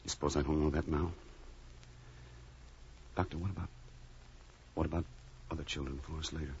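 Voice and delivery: low, soft, halting voice; subdued grief. Acted. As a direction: ask haltingly through guilt and grief. Bereaved father questions doctor.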